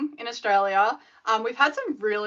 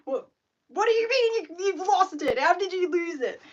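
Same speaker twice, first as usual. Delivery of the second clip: panicked inner scream; 7 semitones higher than usual. Retold or imitated. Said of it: "inside I was screaming".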